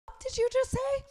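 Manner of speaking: shocked, did-he-really-say-that; retelling her stunned reply to the captor; shocked disbelief. Retold or imitated. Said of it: "I go".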